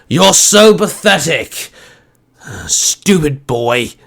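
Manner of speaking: gloating, drawn-out taunt; mocking chuckle. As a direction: sneer down at the fallen boy. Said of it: "Ursa chuckled".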